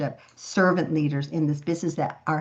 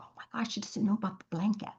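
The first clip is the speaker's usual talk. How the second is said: hushed, breathy whisper to herself; a startled whispered aside; 3 semitones higher, 9 dB quieter, faster than usual. Retold or imitated. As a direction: whisper it so no one hears. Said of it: "I go".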